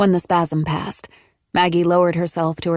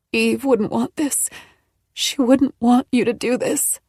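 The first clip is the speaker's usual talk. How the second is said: pleading; 6 semitones higher than usual. Acted. Maggie pleading with Nola.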